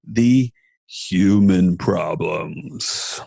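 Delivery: very slow, drawn-out deep voice; deep voice for effect. Real, not acted. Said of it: "You said that with a deep voice".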